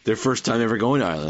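ordinary delivery

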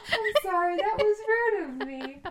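muffled, laughing through a hug. Real, not acted. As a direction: talk muffled into someone's shoulder. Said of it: "my voice is muffled 'cause I'm hugging my sister".

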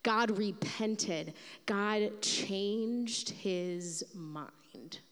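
slow, hushed reading; preacher savoring the surprise, slow and low; slow awe. Real, not acted. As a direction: let each word land in wonder. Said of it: "Other translations say".

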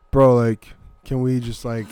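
a hint of Jersey bro; lightly voiced gym bro. The Jersey bro character opening the scene, lightly voiced. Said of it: "What do you wanna do, C J?".

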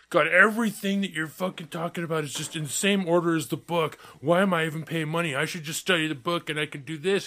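lightly mock the griping classmate; whiny complainer. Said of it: "this guy's bitching. He's like".

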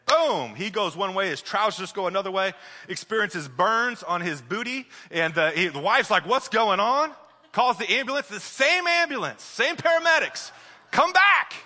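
building from lively telling to a loud, high preaching climax; storytelling preacher hitting full force; rising preaching build. Acted.

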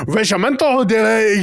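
sudden fake French flourish; mock French. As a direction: burst into showy French like a pompous chef. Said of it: "breaks out into this French shit".

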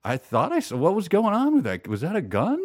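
a friend half sure he saw it; lightly voiced bewildered friend; puzzled friends. Friends unsure what they saw.

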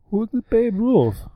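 high, slow put-on Canadian voice; hammed-up Canadian, pitched well up; mock Canadian accent. Podcast host imitating puzzled Canadians, mock Canadian accent. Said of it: "the Canadians were like".

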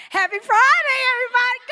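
high, loud sing-song Friday cheer; bubbly greeting. Retold or imitated. Mocking coworkers at work, loud bright Friday cheer. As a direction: sell it like the happiest coworker alive.

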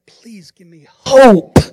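loud, slow cry for hope; pleading yell. Retold or imitated. People in trouble yelling to God for hope, loud and slow. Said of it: "things that you're yelling".

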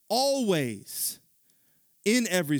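high, loud preaching voice; full-force preaching. Acted. Preacher driving the point home, full force. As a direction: preach it hard to the congregation.

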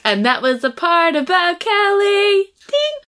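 high, slow singsong announcement; mock segment sign-off. Host closing the part about Kelly, mock announcer.

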